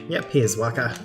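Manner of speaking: ordinary talk, nothing marked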